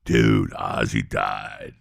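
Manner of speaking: very low, slow monotone quote; friend breaking news. Retold or imitated. Friend tells him [name] died, low stunned drone. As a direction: imitate a friend delivering grim news, deep and drawn out.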